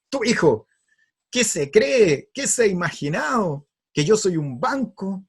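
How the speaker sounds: hammed-up indignation, high and loud; angry father. Father outraged at his student son, angry. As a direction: rant like an outraged dad, drawing out key words. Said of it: "¿Por qué estás enojado?".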